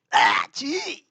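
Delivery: drawn-out, high-pitched 'yuck'; mock disgust. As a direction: recoil in playful revulsion. Mock disgust at cat's milk. (घिन का नाटक करते हुए)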